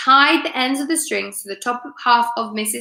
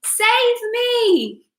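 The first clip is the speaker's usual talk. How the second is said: very high plea, fading away; desperate scream; 12 semitones higher than usual. A narrator's reading. Wife shrieking for rescue from the sky. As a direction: shriek it as she rises. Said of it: "she screamed".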